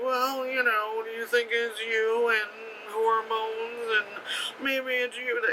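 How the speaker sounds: high, soft mock-condescending voice, last words drawn out; patronizing agency woman. Agency woman dismissively answers brother.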